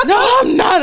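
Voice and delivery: very high, loud laughing protest; mock indignant denial. Imitating Rob denying he's Opie, high mock protest. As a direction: squeal a hammed-up denial. Acted quarrel over TV character.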